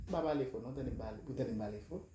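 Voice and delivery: hushed aside away from the phone; secret aside. Acted. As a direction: drop to a conspiratorial hush.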